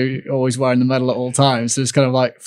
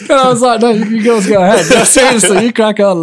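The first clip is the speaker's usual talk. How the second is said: higher, louder, laughing all through; 9 semitones higher, 6 dB louder than usual. Real, not acted. Athlete laughing while quoting himself to girls. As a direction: giggle through the polite offer. Laughing retelling breakfast scene.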